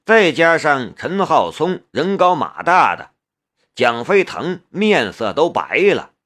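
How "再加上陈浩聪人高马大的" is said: ordinary delivery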